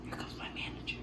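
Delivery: unvoiced whispered aside. Real, not acted. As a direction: hush it conspiratorially. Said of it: "whispered aside".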